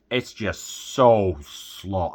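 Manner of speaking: very slow, each word stretched out; dragging it out. Real, not acted. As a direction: let "so slow" crawl to show how slow it is. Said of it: "it's slow, too".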